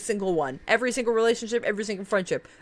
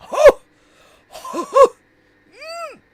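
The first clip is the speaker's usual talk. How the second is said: swooning moan; 10 dB louder, slower than usual. Real, not acted. Swooning over Irene Adler.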